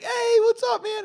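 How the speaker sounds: high, loud put-on hail; overfamiliar friend's greeting. Too-comfortable friend greeting a mobster, imitated high call.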